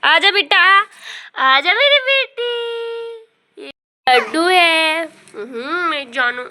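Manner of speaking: very high, loud, slow drawn-out coaxing. Real, not acted. Mother coaxes her baby.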